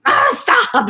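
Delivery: high, loud mock outburst; exasperated father. Daughter mimicking her dad's outburst, exasperated. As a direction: imitate an old man's exasperated cry. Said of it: "he'd be like".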